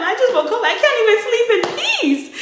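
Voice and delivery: high, loud, laughing protest; hammed-up outburst of exasperation; exasperated whine-yell. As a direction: complain at the top of your pitch.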